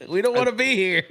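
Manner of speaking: high, loud laughing whine; mock pleading. Salesperson stuck in long meeting, mock pleading. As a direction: protest like a trapped kid, hammed up. Acted plea to leave meeting.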